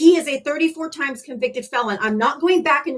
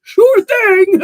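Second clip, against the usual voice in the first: loud, higher sarcastic cackle; hammed-up mocking laughter; 8 semitones higher, 11 dB louder than usual. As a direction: laugh it off in their face. Sarcastic reply to maga.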